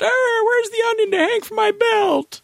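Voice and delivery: put-on high, quavery old man; cranky confused old man. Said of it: "I'm old and confused".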